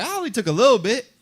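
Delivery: a thief shrugging off the theft; mocking taunt. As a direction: needle him with a smug excuse. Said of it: "Everybody would taunt him like".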